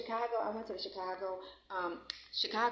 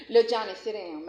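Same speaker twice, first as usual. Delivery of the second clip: sharp loud call to little Johnny to sit; 9 dB louder than usual. Real, not acted. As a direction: call across the room to make him sit. Calls out to a kid.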